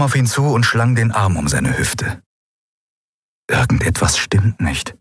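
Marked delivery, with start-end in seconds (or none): 3.5-4.9 breathy whisper to himself; whispered thought. A narrator's reading. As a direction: murmur it under your breath. Kai's worried inner thought.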